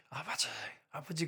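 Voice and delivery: soft, pleading murmur to the Father; hushed prayer. Retold or imitated. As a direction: call on the Father under your breath. Crying out to God in hardship. (조용히 간절하게 부르는 기도)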